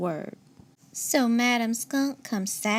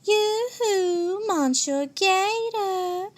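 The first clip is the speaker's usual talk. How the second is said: high, slow, drawn-out teasing call; sing-song call; 10 semitones higher, much slower than usual. A narrator's reading. Mademoiselle Possum calling teasingly down at Monsieur Gator. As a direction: sing it up to him from the tree. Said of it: "she calls".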